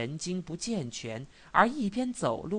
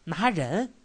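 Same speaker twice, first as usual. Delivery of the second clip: louder, startled echo question; 3 semitones higher, 5 dB louder than usual. A narrator's reading. Resident hearing police are arresting people, startled. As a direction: repeat it back in alarmed surprise. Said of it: "瑞轩吃了一惊".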